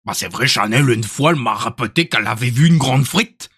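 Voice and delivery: mock Belgian French; Belgian accent. Shown as a demonstration. Streamer obliging a viewer's compliment, hammed-up Belgian accent. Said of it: "L'accent belge?".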